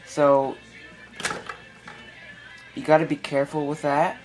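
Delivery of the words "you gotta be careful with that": ordinary delivery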